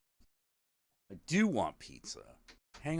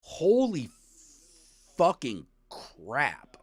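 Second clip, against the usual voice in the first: amazed exclamation; 6 semitones higher, 5 dB louder, much slower than usual. Real, not acted. Amazed at Chinatown's history.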